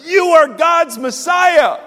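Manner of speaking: high, loud, slow declaration; triumphant declaration of faith; bold proclamation. Retold or imitated. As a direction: shout it out with conviction.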